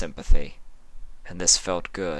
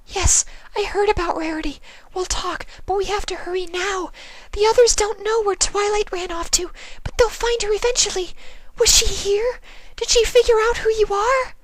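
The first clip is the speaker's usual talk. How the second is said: anxious Fluttershy; 3 dB louder than usual. Acted. Fluttershy asking Sympathy, very high anxious voice. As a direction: ask in a hurried worry.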